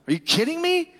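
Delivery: high, disbelieving outburst; incredulous. Retold or imitated. Pastor remembering his frustration, incredulous.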